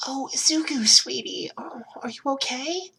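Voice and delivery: high, breathy worried mother; worried mom. Acted. Mom finding bruised Izuku on the couch, anxious and breathy. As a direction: fuss over a hurt son.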